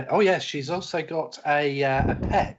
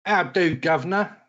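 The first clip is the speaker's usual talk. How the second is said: put-on British accent; 3 semitones higher than usual. Player introduces his character in a British accent. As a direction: greet them like an old Londoner. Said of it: "put on these outrageous British accents".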